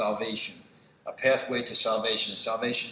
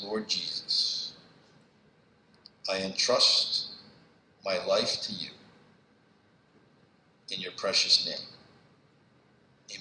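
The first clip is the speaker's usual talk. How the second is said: slow prayer. Real, not acted. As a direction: lead the congregation in a hushed prayer. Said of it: "So I'm gonna read this prayer slowly".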